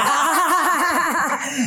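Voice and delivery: big laugh, almost a shout. Real, not acted. Laughs at her own joke.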